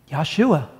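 Yahshua said yah-SHOO-ah; "Yahshua" as a Hebrew name; Hebrew pronunciation. Retold or imitated. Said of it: "That's how they say it".

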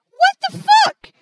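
high, loud and drawn-out; slow, high shout of frustration; real furious outburst. Real, not acted. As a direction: yell it in outraged disbelief. Ruined recording, must redo everything.

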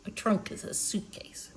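whispered explanation. Real, not acted. Reader explaining a word to the child, whispered. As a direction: hush it as an aside.

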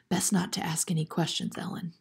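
soft, confidential whisper; hushed whisper. A narrator's reading. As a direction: whisper a quiet caution. Mother answers a whispered question.

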